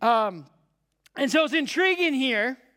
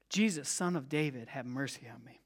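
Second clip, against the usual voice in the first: much softer, near-whispered plea; hushed desperate cry; 10 semitones lower, 9 dB quieter than usual. Retold or imitated. Blind man pleading to Jesus, hushed anguished cry. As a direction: pour it out low, like a lost child. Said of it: "This is a different tone".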